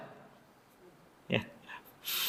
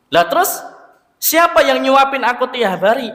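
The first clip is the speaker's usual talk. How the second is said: put-on higher, suspicious old beggar pressing the stranger; beggar's voice; 9 semitones higher, 4 dB louder than usual. Blind beggar tells Abu Bakar he is a different man, in a higher questioning voice. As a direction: pitch it up as the blind beggar who senses something is off. Beggar asks who fed him.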